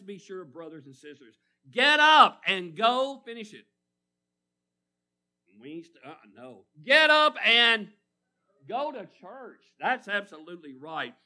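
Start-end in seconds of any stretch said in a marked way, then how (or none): 1.7-3.6 slow, loud, drawn out; loud slow call. Real, not acted. Preacher calling the church to get up, loud. 6.8-9.6 higher and louder, slow on "Get up"; loud call. Real, not acted. Preacher repeating the refrain loudly.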